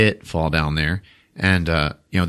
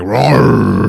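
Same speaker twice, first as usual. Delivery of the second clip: mock tiger roar; 5 dB louder than usual. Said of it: "he's like".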